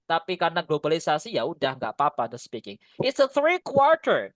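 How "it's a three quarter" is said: mock wrong example. Shown as a demonstration. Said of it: "Nggak boleh sebenarnya ya".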